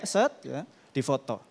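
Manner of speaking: normal talking voice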